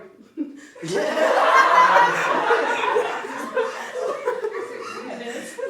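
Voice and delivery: shrieking embarrassed laugh; high laugh. Real, not acted. Caught copying an accent, bursting into high laughter. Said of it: "I almost copied your accent entirely".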